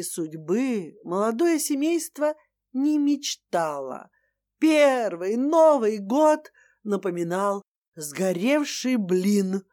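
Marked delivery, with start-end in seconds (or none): none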